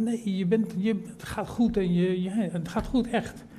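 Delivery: calm the doubting preacher down; a hint of a gentle God; reassuring God.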